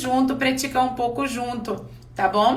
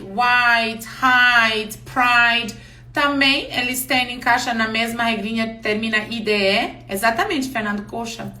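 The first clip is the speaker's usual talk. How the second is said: ordinary talk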